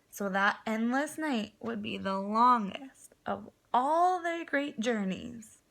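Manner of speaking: plain reading voice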